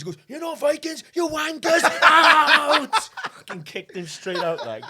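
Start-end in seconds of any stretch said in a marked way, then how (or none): 0.3-2.9 high, loud yelled retort, building to a bellow; hammed-up angry bar owner throwing them out; mock furious shout. Bar boss to boastful Vikings, mock furious shouting.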